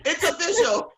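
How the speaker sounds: high, laughing declaration; amused high "It's official". Real, not acted. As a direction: say it while giggling.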